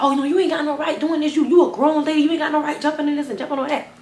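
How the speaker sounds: high, laughing scold; mocking a grown lady. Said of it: "But I'm just like".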